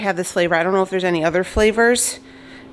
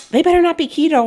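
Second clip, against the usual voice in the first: high, louder mock warning to a friend; friend's mock warning; 10 semitones higher, 3 dB louder, faster than usual. Friend warning her about keto biscuits, high mock scold. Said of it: "And she's like".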